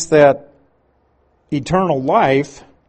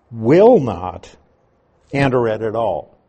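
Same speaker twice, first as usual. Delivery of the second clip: "will not" pushed out louder, firmly; emphatic will not; 3 semitones lower, 5 dB louder than usual. Shown as a demonstration. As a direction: hammer "will not" home. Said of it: "what it meant was emphasis".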